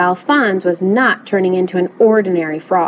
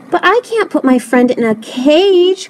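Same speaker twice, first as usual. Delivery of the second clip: higher-pitched protest; crying out; 6 semitones higher than usual. A narrator's reading. Lewis protests caging his friend, crying out. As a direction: plead in mild dismay. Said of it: "cried Lewis".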